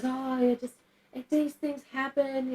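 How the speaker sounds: mock-soothing, high and drawn-out brush-off; dismissive doctor. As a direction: imitate a doctor waving worries away. Imitates gynecologist brushing off her concern.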